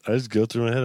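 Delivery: usual speaking voice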